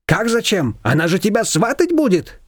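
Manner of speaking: high, lively neighbour voice; Shushana's voice. A narrator's reading. Neighbour brushing off Nina, high and bossy.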